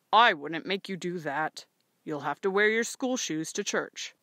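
firm father. Acted.

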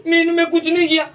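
high, stammering protest; mock-panicked denial; caught suspect pleading. Shown as a demonstration. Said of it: "फिर ना तेरे को पकड़ा ना".

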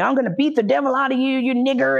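high, fast imitated tirade; acted threat. Attacker hitting his captive, imitated high-pitched rant. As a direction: rush it out as a ranting attacker.

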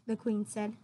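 usual speaking voice

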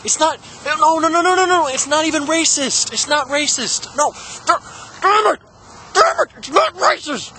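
high, frantic denial; mock panicked protest. Mock protest against racism charge.

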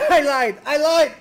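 laughing yell. Real, not acted. Laughing after a blunder.

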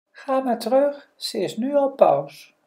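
high-pitched fish voice; light high storybook fish; magic fish. Acted. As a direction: send him back in a high creature voice. The fish grants the wish.

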